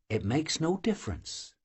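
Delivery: softly, almost under her breath; quiet reply. A narrator's reading. Marianka brushing off her friend, quietly. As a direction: let it drop, low and quiet. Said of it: "Marianka replied quietly".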